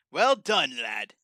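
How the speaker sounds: booming pirate brogue; hearty sea dog greeting. Acted. John character voice, pirate-like.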